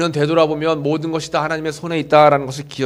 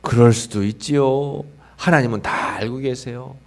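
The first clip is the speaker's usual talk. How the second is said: gentle confession; 4 semitones lower, 6 dB quieter than usual. Shown as a demonstration. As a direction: say it calmly, letting go. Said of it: "이렇게 고백해야 돼요".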